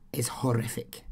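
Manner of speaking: whisper. Real, not acted. Whispered aside on the murder.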